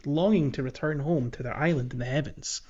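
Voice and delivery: usual speaking voice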